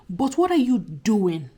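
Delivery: ordinary talk, nothing marked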